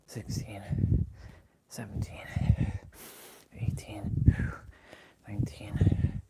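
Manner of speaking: whispered count; whispering. Real, not acted. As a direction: breathe the numbers out. Whispered counting during exercise.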